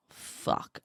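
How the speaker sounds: breathy whispered swear under the breath; a defeated whisper; whispered curse. Retold or imitated. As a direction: mutter it so nobody hears. Realising the windshield costs more.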